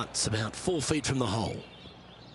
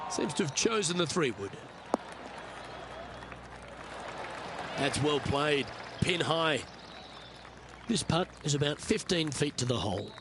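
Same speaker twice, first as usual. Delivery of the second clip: calm, measured golf commentary; golf commentator; 3 dB quieter than usual. Acted. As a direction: call the shot like a TV golf announcer. Golf play-by-play narration.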